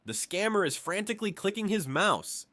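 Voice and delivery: plain reading voice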